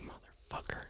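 breathy whispered swear under the breath; whispered curse. Retold or imitated. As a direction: hiss it so he can't hear. Silent curse at the coach.

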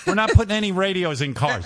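high, hammed-up angry refusal; mock refusal. Executives refusing the radios in a hammed-up angry voice. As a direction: slam the door on the deal. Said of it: "executives refusing".

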